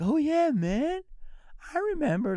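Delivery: high, easygoing star voice; friendly actor. Retold or imitated. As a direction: play the famous actor warmly recognising him. Imitating Ethan greeting him.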